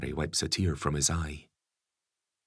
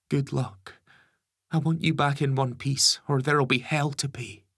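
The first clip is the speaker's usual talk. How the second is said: higher gentle mother's voice; mum voice; 9 semitones higher, 5 dB louder than usual. Acted. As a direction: coax her son like a mum.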